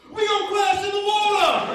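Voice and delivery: high, loud terrified yell; panicked scream. Retold or imitated. Passenger screaming that the plane will crash, high and loud.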